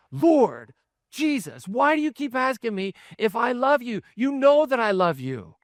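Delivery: a friend fed up with being asked; frustrated. Acted. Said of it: "Peter starts to get frustrated".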